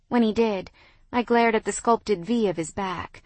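plain reading voice

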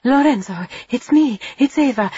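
high, loud urgent cry; urgent call. A narrator's reading. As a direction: break through his panic. Calming the panicked boy.

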